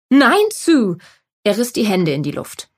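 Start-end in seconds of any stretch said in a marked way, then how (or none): 0.1-1.0 lautes Nein. A narrator's reading. Jeff wehrt die Frage ab.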